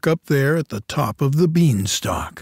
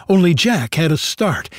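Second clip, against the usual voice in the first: usual speaking voice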